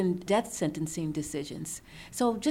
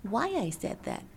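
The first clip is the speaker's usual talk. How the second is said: mock-sad child, fading to a whisper; a hint of a sad five-year-old, hushed at the end; sad little voice; 4 semitones higher, 3 dB quieter than usual. Mother retelling her son's confused apology, softly sad and whispered. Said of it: "with this really sad face".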